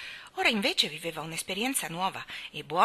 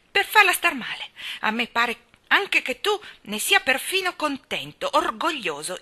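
loud, higher accusing tirade; scathing scolding; 6 semitones higher, 7 dB louder than usual. Acted. Mary accuses Colin of faking, scathing. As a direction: lay into him without mercy. Mary berates her cousin.

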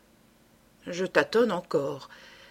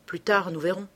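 usual speaking voice

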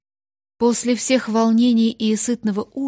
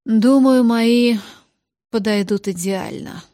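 smirking; 2 semitones higher, 7 dB louder than usual. Acted. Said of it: "хмыкнул Льер и Дамас".

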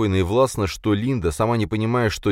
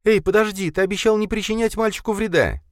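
frightened shout. A narrator's reading. Linda calling after Khalid down the tunnel, frightened. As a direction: call after him in fear. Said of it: "испуганно закричала ему вслед".

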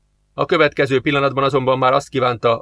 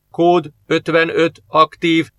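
machine voice; 5 semitones higher, 3 dB louder, slower than usual. Acted. Device displays alert code.

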